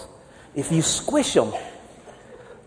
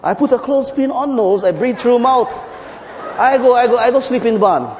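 put-on higher, confident Japanese-accented English; 7 semitones higher than usual. Japanese guy assuring the farmer, higher Japanese accent. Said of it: "So the Japanese guys go".

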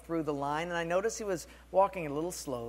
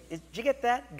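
ordinary talk, nothing marked

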